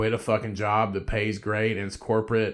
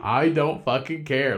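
slower, louder, emphatic; emphatic repeat; 4 semitones higher, 5 dB louder than usual. Retold or imitated. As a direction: lean hard into each word. Repeats line, laughing emphatic.

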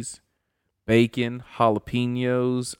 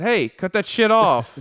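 higher, louder, slow mock yell at the cat; retold yell; 8 semitones higher, 5 dB louder, slower than usual. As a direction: bark it slowly at the meowing cat. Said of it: "I yelled at her".

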